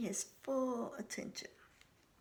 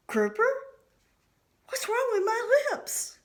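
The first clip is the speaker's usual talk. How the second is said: alarmed; 14 semitones higher, 8 dB louder than usual. A narrator's reading. Panicked inner thought.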